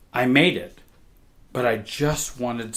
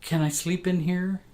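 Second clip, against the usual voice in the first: put-on small scared child; 6 semitones higher, 3 dB quieter than usual. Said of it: "I said".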